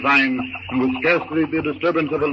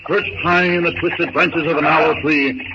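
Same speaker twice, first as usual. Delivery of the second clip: ordinary delivery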